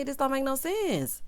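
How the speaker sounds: normal talking voice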